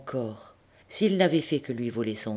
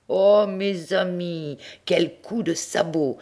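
gleeful relish; 6 semitones higher, 4 dB louder, slower than usual. A narrator's reading. Narrator relishes the kick.

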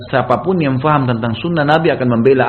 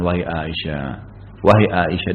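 soft, lowered kind call; gentle and courteous; 7 semitones lower, 5 dB quieter than usual. Retold or imitated. Prophet gently calling his wife Aisyah, soft and courteous. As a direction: call her name softly, with respect. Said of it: "dengan suara baik, santun".

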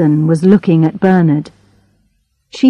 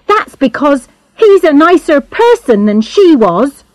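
heated, higher-pitched comeback; hot retort; 9 semitones higher, 3 dB louder than usual. A narrator's reading. Charlotte defends Mister Blakemore to the inspector, hot retort. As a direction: fire back in his defence. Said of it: "said Charlotte hotly".

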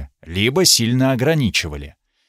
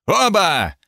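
barked royal command, higher; roaring tyrant king; 6 semitones higher than usual. Acted. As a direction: snap the order like an angry king. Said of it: "рявкнул король". (королевский рявк)

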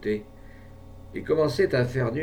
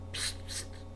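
psst call; 12 dB quieter than usual. A narrator's reading. Calling animal softly.

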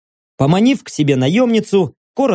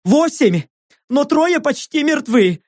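panicked pleading; 10 semitones higher than usual. Acted. Panicked act continues.

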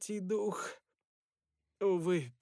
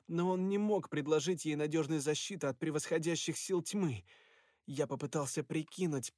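usual speaking voice

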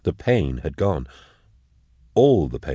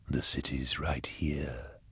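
slow, hushed whisper; eerie unseen voice; 2 semitones lower, 12 dB quieter, slower than usual. A narrator's reading. Demon voice points a dazed man to the city, eerie whisper. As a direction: breathe it out softly and slowly.

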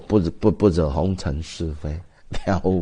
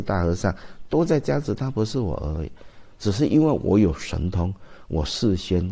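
ordinary talk, nothing marked

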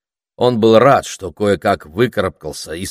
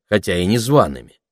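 plain reading voice

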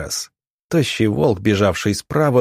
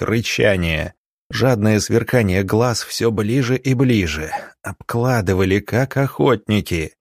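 usual speaking voice

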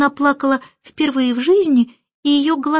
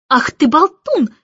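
louder, higher burst of annoyance; angry scolding; 4 semitones higher, 5 dB louder than usual. A narrator's reading. Nanny rushing at the chattering Starling, angry scolding. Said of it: "рассердилась Мэри Поппинс".

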